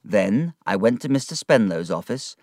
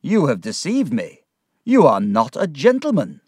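stern, raised-voice accusation; indignant reproach, pitched up; angry; 6 semitones higher, 6 dB louder than usual. Acted. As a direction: accuse him indignantly, higher and firm. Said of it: "His face was angry".

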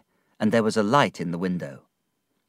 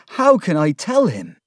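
high, loud desperate question; anguished plea; 8 semitones higher, 7 dB louder than usual. A narrator's reading. Ham at a loss to break the news, desperate. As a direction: cry it out helplessly.

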